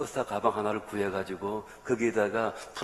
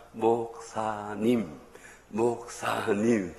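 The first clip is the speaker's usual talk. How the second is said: each syllable drawn out very slowly; slow teaching demo; much slower than usual. Shown as a demonstration. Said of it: "따라합니다".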